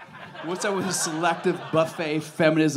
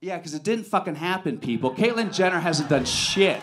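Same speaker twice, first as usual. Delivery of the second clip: usual speaking voice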